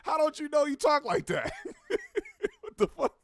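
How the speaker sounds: high-pitched, cracking up mid-sentence; laughing incredulously. Real, not acted. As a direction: ask it through helpless, incredulous laughter. Laughs at the clip he played.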